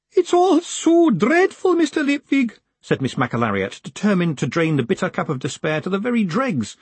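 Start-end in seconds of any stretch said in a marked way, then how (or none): 0.1-2.6 high, slow, loud lament; high slow weepy wail; despairing. Acted. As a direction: wallow in the despair.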